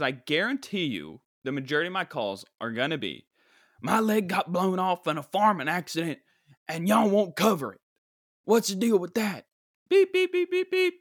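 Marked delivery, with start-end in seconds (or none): 3.8-9.5 loud, high-pitched irate caller impression; angry caller. Angry caller denied insurance cover, hammed-up outrage. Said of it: "calls all day from angry people". 9.9-10.9 very high, loud beeps; imitated censor beeps. Bleeping out an angry caller's swearing, high beeps.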